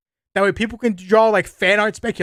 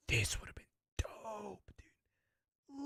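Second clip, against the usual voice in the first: slow breathless whisper; 3 semitones higher, 21 dB quieter, much slower than usual. Real, not acted. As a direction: whisper it in awe. Whispered take of same line.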